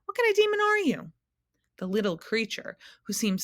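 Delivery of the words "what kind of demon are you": high, loud astonished demand; a startled boy's yelp of surprise; shocked question. A narrator's reading. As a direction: cry it out in disbelief. Milo confronts the demon.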